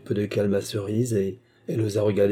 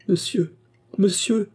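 high, urgent plea; raised imploring voice; pleading; 10 semitones higher, slower than usual. A narrator's reading. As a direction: beg him in mild desperation. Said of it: "supplia Cerise, se tordant les mains".

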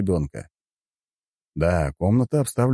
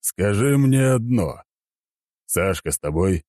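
emperor on phone; slower than usual. A narrator's reading. Emperor questions hero by phone.

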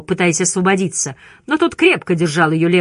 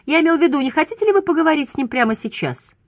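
raised, higher-pitched voice; insistent clarification; 9 semitones higher, faster than usual. A narrator's reading. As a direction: explain with a pointed, high-voiced question. Judge answers Rebus in dialogue.

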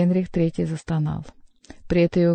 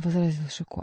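ordinary delivery, nothing marked

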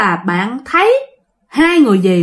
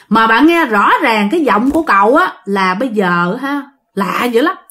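usual speaking voice

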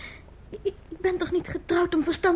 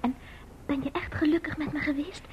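whisper; 3 semitones lower, 8 dB quieter than usual. Acted. Bange vraag aan echtgenoot.

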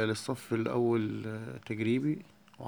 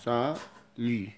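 very slow, drawn-out سالي; slow teaching demo of سالي; much slower than usual. Shown as a demonstration. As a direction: stretch سالي out syllable by syllable. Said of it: "التي لا تنطق في الآخر".

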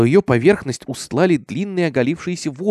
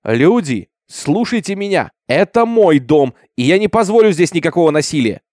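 shouting for order; 5 semitones higher, 6 dB louder than usual. A narrator's reading. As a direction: call over a crowd, raised and measured. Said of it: "он поднял руку и закричал".

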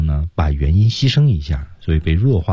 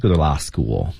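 usual speaking voice

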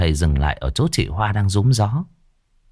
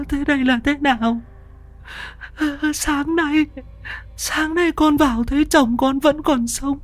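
put-on high, whining old woman's wail; whimpering wail. A narrator's reading. Mrs Xuân wails for her husband in the room, high and whimpering. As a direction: wail in a high voice, near tears. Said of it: "miệng mếu máo".

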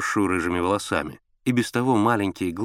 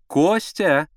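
high woman's call from another room; raised, high summoning voice; 8 semitones higher, 4 dB louder than usual. A narrator's reading. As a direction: call for your husband from the bedroom. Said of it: "донёсся из спальни голос Аллы Сергеевны".

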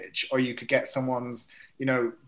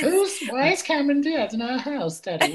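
mock child voice; 12 semitones higher than usual. Mimicking film child.

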